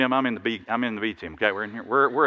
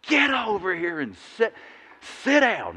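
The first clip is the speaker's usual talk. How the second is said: half-acted scolding of players; retold sharp call to the bench; furious coach; 8 semitones higher than usual. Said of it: "and I was furious".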